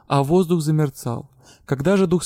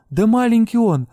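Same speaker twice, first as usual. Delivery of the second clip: pleading protest; 7 semitones higher, 5 dB louder than usual. A narrator's reading. Heroine protects the kitten.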